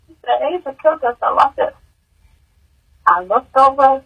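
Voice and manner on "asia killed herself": usual speaking voice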